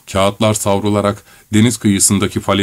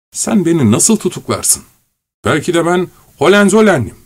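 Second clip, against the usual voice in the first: manic; 7 semitones higher than usual. A narrator's reading. Korotkov raving madly.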